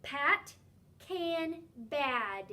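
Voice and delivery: words spoken clearly for the class; clear teacher word reading. Shown as a demonstration. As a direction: read each word slowly and distinctly. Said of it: "listen for the sound that you hear in the medial position".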